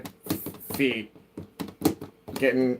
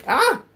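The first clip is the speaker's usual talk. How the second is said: sudden high yelp; startled yelp; 6 dB louder than usual. Real, not acted. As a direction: yelp as something yanks you. Cat hits headphone cord.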